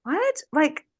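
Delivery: high, loud incredulous outburst. Retold or imitated. Recalling her friend always being there, high loud disbelief. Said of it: "I, I was like".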